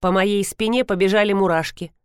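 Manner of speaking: ordinary delivery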